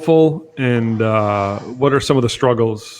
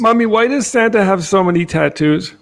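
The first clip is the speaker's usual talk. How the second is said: higher-pitched mock little kid; child asking mommy; 9 semitones higher than usual. Kid asks mommy about Santa's tattoos, mock child voice. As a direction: pipe up like a puzzled kid tugging at mommy.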